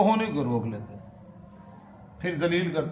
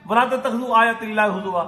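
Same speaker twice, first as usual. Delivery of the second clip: verse declaimed high and loud; preacher warning the faithful, declaimed loud and high; forceful recitation; 7 semitones higher, 7 dB louder, faster than usual. Acted. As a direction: ring it out to the hall.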